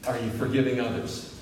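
normal talking voice

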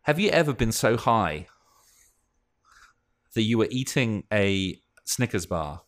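normal talking voice

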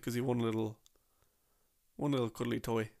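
normal talking voice

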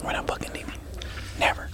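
fierce whisper. Pretend. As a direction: whisper it defiantly. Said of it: "Why are we whispering?".

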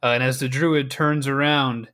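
ordinary delivery

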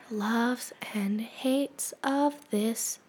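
slow whispered segment intro; breathy slow announcement. Acted.

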